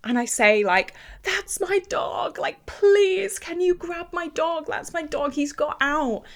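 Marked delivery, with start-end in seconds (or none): 1.2-6.2 high, pleading mock shout to strangers; reenacting begging the couple to grab the dog; retold desperate plea. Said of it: "I shout to this couple".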